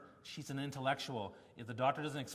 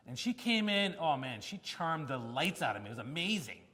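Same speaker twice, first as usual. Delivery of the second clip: ordinary talk, nothing marked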